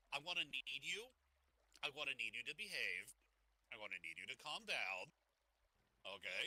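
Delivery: low, soft coaxing voice; calm him like a dog; soothing. Acted. Co-host calming his excited partner, low coaxing.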